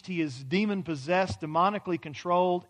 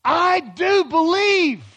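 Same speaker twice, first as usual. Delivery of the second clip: high, loud, slow cry of belief; a desperate father calls out to Jesus, loud and slow; father exclaims; 10 semitones higher, 11 dB louder, much slower than usual. Retold or imitated. Father crying out to Jesus, high, loud and slow. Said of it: "He exclaimed".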